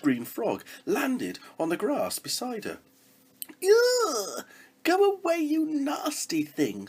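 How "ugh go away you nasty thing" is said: high, loud disgusted shriek; screaming. Acted. Princess shrieking at the frog, disgusted scream. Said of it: "screamed the princess".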